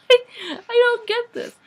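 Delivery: slow, high, laughing through it; real laugh of disbelief. Real, not acted. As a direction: laugh while saying it, baffled. Laughing at the book.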